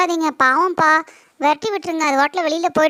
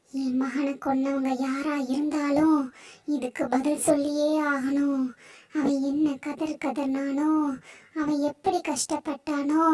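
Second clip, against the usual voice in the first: grieving snake goddess; 7 dB quieter than usual. Acted. Snake goddess grieves her killed son, soft and mournful. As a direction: mourn the son quietly, vowing revenge.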